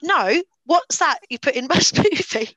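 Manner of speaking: retold indignant demand broken by a laugh; mock angry. Retelling date's row with smoothie guy, mock angry. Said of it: "Started getting so angry".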